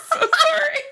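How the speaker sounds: giggling through it, very high; laughing apology. Real, not acted. Laughing after singing outburst.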